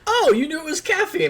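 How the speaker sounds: very high-pitched mock amazement; imitated delighted surprise. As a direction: play a stranger thrilled to be recognised. Voicing surprised stranger's reply.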